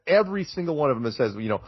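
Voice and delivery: usual speaking voice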